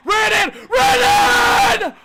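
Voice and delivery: real full-throated high screaming, words stretched out; wild, drawn-out commentator scream; ecstatic goal call. Real, not acted. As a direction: lose it completely over the goal.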